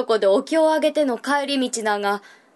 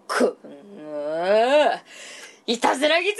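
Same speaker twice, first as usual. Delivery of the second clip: put-on angry old monk; 2 semitones lower than usual. Monk strikes angry at fox.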